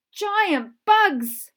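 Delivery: very loud, high terrified scream. Acted. Lucy spots the giant bugs, screaming. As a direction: scream it in terror. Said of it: "screamed Lucy".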